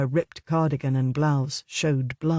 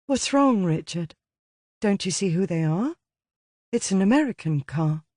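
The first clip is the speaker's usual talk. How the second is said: a hint of a worried young woman, higher; urgent, slightly raised girl's voice; lightly voiced young woman; 5 semitones higher than usual. Francis voiced by male narrator.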